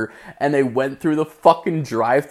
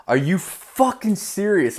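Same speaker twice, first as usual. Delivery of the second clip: high, strained outraged disbelief; incredulous outrage; 8 semitones higher than usual. Retold or imitated. To the bank that says the account was hacked, high incredulous outrage. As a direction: voice cracks up in outrage.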